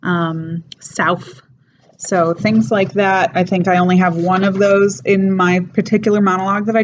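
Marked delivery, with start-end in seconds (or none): 0.8-1.4 "south" pronounced sowf. Shown as a demonstration. Teacher giving a last example, "south" as sowf. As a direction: end "south" on an F. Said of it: "comes out as an F".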